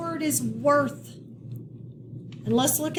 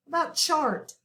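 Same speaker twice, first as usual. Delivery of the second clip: usual speaking voice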